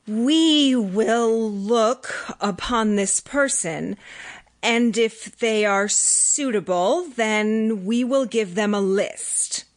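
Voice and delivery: slow, halting voice, words not yet mastered; croaking, halting alien. A narrator's reading. Alien in a human body answers the servant, slow and halting. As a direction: struggle with a new throat, slow. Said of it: "our voice croaking and halting because".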